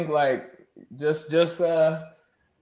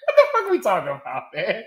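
laughing shout, fast; cracking up mid-sentence; 3 semitones higher, faster than usual. Real, not acted. Bursting out laughing.

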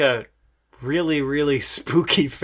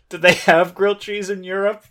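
acted naive question; 5 semitones higher, 5 dB louder than usual. Acted question the player almost asked.

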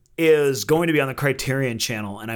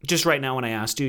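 ordinary talk, nothing marked

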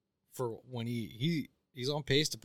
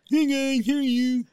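loud, much higher slurred greeting; oiled-up deaf guy; 11 dB louder than usual. Retold or imitated. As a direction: call out loud and high, words slurred. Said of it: "you sound like an oiled up deaf guy".

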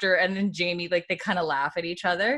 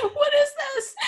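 shrieking, laughing high squeal; hammed-up scandalized squeal; shocked Jordy. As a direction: shriek it in mock horror. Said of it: "Jordy's like".

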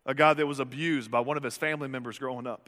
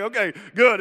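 relieved; 6 semitones higher, 9 dB louder than usual. Retold or imitated. Disciples relieved.

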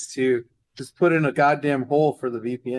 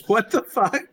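baffled outburst; 7 semitones higher, 3 dB louder, slower than usual. Real, not acted. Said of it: "It's like".

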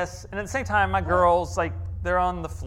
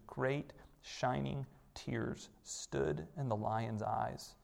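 hushed, slow, lower story reading; reading Narnia to his daughters, soft and slow; 4 semitones lower, 6 dB quieter than usual. A narrator's reading. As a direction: let the passage settle in slowly and quietly. Reads second Narnia passage.

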